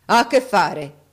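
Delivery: loud, menacing challenge; thundering demand. A narrator's reading. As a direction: boom the question down. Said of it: "tuonò l'uomo di bronzo".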